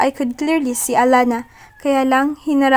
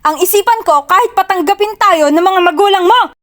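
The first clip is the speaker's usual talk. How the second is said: higher, loud final burst; loud outburst; 6 semitones higher, 6 dB louder than usual. Acted. Achilles yelling his resolve at her, loud outburst. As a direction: let the last words explode. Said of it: "He said the last words so loud".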